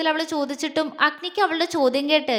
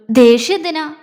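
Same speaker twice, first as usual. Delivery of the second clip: louder, a sudden why would I be angry; louder astonished question; surprised exclamation; 2 semitones higher, 9 dB louder than usual. Acted. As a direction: burst out in disbelief. Jwala reassures Meenu, exclaiming.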